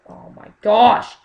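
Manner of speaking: loud, drawn-out cry of alarm; alarmed outburst. Real, not acted. Gamer caught off guard mid-fight, alarmed outburst. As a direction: blurt it loudly, stretching the words out. Panics while playing a shooter.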